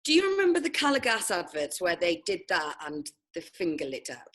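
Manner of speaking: normal talking voice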